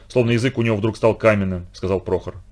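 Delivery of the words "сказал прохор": usual speaking voice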